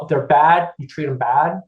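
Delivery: ordinary delivery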